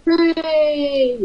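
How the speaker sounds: hooray shouted in delight; cheering. Real, not acted. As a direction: cheer him on with a whoop. Host cheers the caller.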